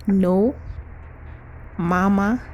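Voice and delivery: usual speaking voice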